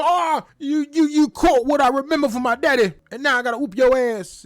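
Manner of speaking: imitating Wardlow's threat. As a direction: voice him hyped and ready to fight. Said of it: "is what he told Joe".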